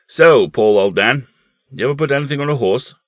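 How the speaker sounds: loud, hearty uncle voice; jovial uncle. Acted. Uncle asking his nephew about horses, jovial and loud. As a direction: boom it out to a young nephew.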